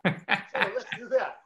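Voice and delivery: laughing through the words, loud and slow. Real, not acted. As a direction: break into a loud laugh while speaking. Laughing as he takes over.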